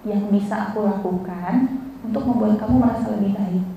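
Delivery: low, soft caring question; gentle offer of help. Shown as a demonstration. Teacher demonstrates what to ask a sad friend, gentle offer. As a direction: ask softly and low, with care. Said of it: "Tanya, cuma ini coba".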